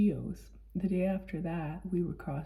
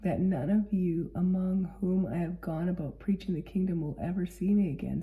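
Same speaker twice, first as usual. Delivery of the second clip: ordinary talk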